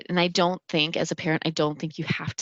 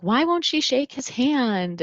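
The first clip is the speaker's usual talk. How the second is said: put-on higher, slower little-kid question; puzzled child; 8 semitones higher, slower than usual. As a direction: ask it like a confused small child. Young child puzzled by film.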